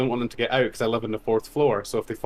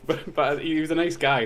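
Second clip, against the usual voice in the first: usual speaking voice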